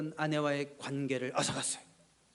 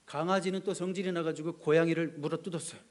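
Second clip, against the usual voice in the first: ordinary talk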